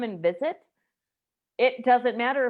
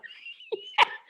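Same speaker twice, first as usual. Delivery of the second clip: high squealing laugh. Real, not acted. Laughing at husband's reply.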